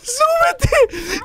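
very high, strained cry; frantic urging. Real, not acted. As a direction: squeal it high, urging them upward. Urging player to climb up.

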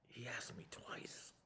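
slow hushed whisper of disbelief; whispered thought. Acted. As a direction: whisper it to yourself, stunned. Peter's inner thought whispered.

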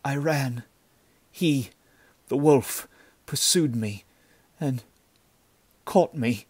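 put-on wounded girl forcing out broken phrases; shuddering, dying away. A narrator's reading. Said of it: "The words died away in a shuddering silence".

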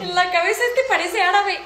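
broma dicha entre risas; riendo. Real, not acted. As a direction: dilo muerta de risa. Bromea riendo sobre el peinado. (riendo mientras bromea)

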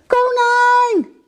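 high, wailing shout; calling out. Acted. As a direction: call loudly after a friend being taken away. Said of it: "riep het hondje". (luid, hoog uitroepend)

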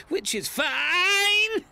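crying. Acted. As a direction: voice breaking into sobs. Crying about wife's new man.

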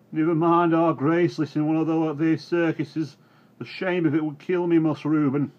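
put-on high, loud, dismayed old man; pleading old farmhand. A narrator's reading.

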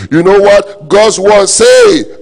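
high, loud, very slow build-up; preaching shout. Acted. Pastor building to God's promise, loud and drawn out. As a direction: drag it out to build suspense.

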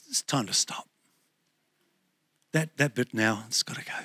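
soft, firm, near-whispered command; gently but firmly say it must go; quiet firm Lord. Retold or imitated. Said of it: "he says".

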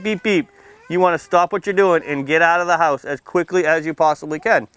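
ordinary talk, nothing marked

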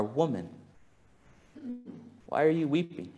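quiet, tender asking; barely above a murmur, full of care; hushed gentle question. Acted. Jesus speaking first words to Mary, hushed and tender.

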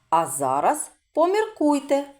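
normal talking voice

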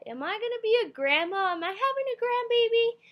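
high, loud gushing questions; emotional grandma-to-be. Retold or imitated. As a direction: imitate an overjoyed mom, pitch climbing. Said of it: "so emotional and stuff".

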